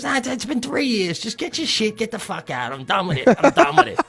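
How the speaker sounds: soft, high-pitched grumbling; hushed, squeaky mock exasperation; imitated fed-up neighbor. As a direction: mimic a man muttering he has had enough. Rod's retold reply, quieter, high.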